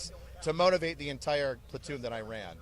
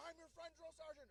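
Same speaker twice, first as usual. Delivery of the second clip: much higher, softer, distant reply; eager recruit; 13 semitones higher, 25 dB quieter, faster than usual. Retold or imitated. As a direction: pipe up from far back. Private answering from afar.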